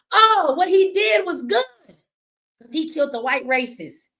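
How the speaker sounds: hammed-up mockery of the crowd; mocking quote. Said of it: "so you stupid motherfuckers can say".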